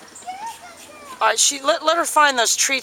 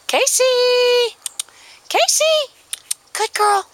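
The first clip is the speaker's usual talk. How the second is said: calling the dog; 6 dB louder than usual. Real, not acted. Recall training calling the dog.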